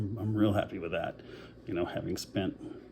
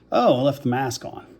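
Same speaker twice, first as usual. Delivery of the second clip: higher, louder mock "oh!" of discovery; sudden realization; 5 semitones higher, 6 dB louder than usual. Retelling finding the mask still on, sudden realization. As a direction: act out the moment it dawns on you.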